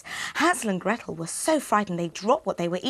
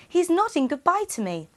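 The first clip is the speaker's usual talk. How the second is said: put-on higher, quick little boy voice; a child explaining eagerly, higher and fast; boy Hansel; 9 semitones higher than usual. Hansel tells his father, high quick boy.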